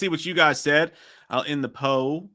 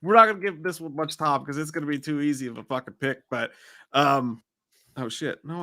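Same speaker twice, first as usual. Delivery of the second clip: normal talking voice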